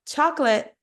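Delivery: chocolate shortened to CHAWK-lit; English pronunciation. Shown as a demonstration. Teacher cites what Brazilians fear saying, clipped English chocolate. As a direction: say chocolate as two English syllables, middle swallowed. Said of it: "se a gente falar".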